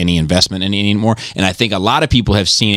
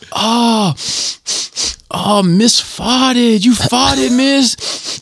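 high, drawn-out mock schoolboy jeer; mimicked school troublemaker; much slower than usual. Schoolboy accusing his teacher, high stretched-out mocking voice. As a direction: taunt in a put-on high kid voice. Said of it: "And then he's like".

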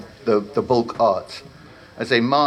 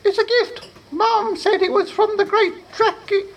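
very high, eager squeak; squeaky creature. Acted. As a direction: chirp it like a proud goblin. Apprentice proudly explains.

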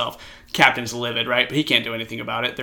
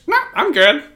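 much higher, louder parrot mimicry; parrot echo; 10 dB louder than usual. Hidden parrot repeating its owner to the attendant, high parrot voice. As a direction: squawk it back like a parrot copying.

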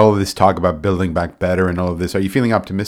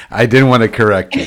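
laughing through the words, louder; 4 semitones higher, 7 dB louder than usual. Real, not acted. Host teasing the guest about bestest, laughing. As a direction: chuckle as you tease her gently.

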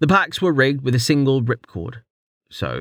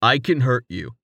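flatly. A narrator's reading. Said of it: "Rain said flatly".

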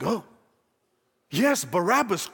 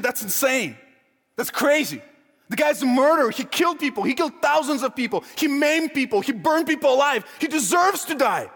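higher, insistent indignant protest; outraged; 5 semitones higher than usual. Acted. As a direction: argue like an appalled member of the public. Acted public outrage at freeing killer.

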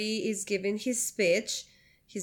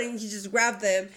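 ordinary talk, nothing marked

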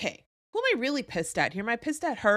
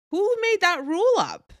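who made that rule up, pitched way up; indignant outburst; 10 semitones higher, 7 dB louder than usual. Real, not acted. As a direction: voice jumps high and loud on the challenge. Rhetorical challenge to listener.

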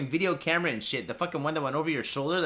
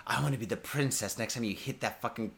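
a lower, softer mock come-on; mock sultry woman; 6 semitones lower, 6 dB quieter than usual. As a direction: purr it as a mock seductress. Voicing a seductive virtual prostitute.

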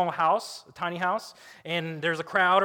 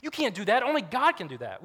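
high, indignant protest; acted-out flustered objection; angry scribes; 6 semitones higher, 3 dB louder than usual. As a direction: object in outrage, pitch up. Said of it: "They're angry".

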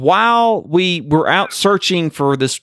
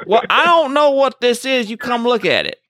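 higher-pitched country farmer, lightly imitated; lightly voiced farmer; 10 semitones higher than usual. Farmer to firefighters about a find, lightly imitated. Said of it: "this farmer waves us down".

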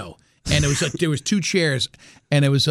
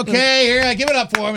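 higher mock host calling for applause; lightly acted announcer drumming up the crowd; 6 semitones higher than usual. Acting out the host's announcement.

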